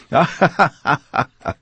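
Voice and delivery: high, joyful burst of laughter; a hearty delighted laugh; splendid laugh. Acted. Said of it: "it was a splendid laugh".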